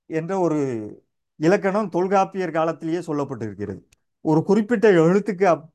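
usual speaking voice

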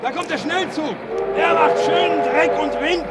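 high, loud yells over the roaring express; shouting over a train. Acted. Railwayman warns the journalist as the express roars past, shouting.

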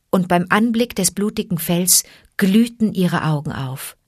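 ordinary delivery

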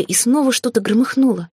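ordinary delivery, nothing marked

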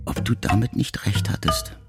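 ordinary delivery

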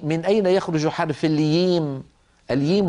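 "الييم" with y in place of j; y replacing j in "الييم"; jim said as ya. Retold or imitated. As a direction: quote the questioner, saying "الييم" for al-jim. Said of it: "وقصده حرف الجيم".

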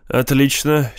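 plain reading voice

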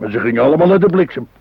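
loud, fast, coarse blurt; crude outburst. Acted. Husband blurts a crude joke to his worried wife, loud and fast. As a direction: blurt it out to shock her.

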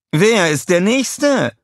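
high, loud, slow villainess challenge; a high, loud, slow villain's dare to the crowd; Scarlett calling out. A narrator's reading. Scarlett dares the villains, calling high and loud. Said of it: "rief Scarlett".

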